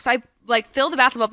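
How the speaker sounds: ordinary talk, nothing marked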